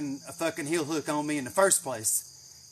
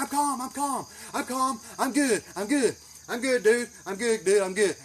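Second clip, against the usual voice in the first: raised, insistent reassurance; agitated show of being calm; faking calm; 7 semitones higher, 4 dB louder than usual. Retold or imitated. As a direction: keep repeating it as if barely holding it in. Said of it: "trying to play good and calm".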